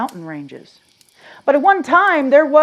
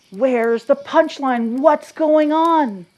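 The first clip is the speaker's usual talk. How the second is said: mock puzzled; 5 semitones higher, 4 dB louder, slower than usual. Voicing the puzzled audience.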